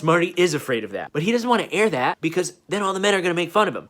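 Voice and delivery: usual speaking voice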